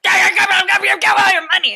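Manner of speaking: high, very fast mock shouting; hammed-up panicky stick-up man; frantic robber. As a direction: gabble the demand in a frenzy. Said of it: "that other guy was like".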